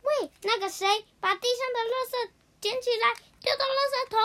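put-on cheeky kid parroting the principal; mocking imitation. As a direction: parrot the principal's order back in a high, cheeky copy. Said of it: "就学着说".

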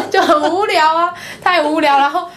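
giggling about how dull class was; laughing. Real, not acted. Laughing, recalling boredom.

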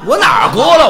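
mock protest. Straight man accused of crying by singer, loud comic protest. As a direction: indignantly deny it, raising your voice.

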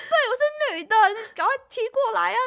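high, loud challenging dare; defiant taunt. Retold or imitated. Answering the opponent, high loud defiant dare. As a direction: dare him to come at her.

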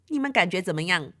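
ordinary delivery

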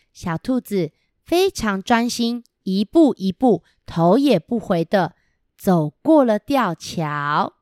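usual speaking voice

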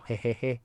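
soft awkward chuckle; nervous laugh. Real, not acted. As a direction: laugh it off, a little embarrassed. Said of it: "这个有点尴尬".

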